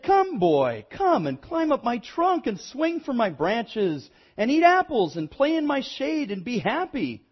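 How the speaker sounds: lively, swooping invitation with high peaks; eager tree. A narrator's reading. Tree calls the boy to climb and play, eager.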